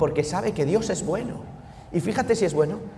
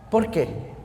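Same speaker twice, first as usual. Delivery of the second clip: usual speaking voice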